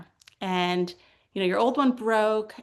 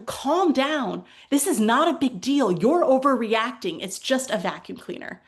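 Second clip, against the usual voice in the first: louder, emphatic acted brush-off; mock dismissive agent; 4 semitones higher, 5 dB louder than usual. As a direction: talk over an upset customer, emphatically.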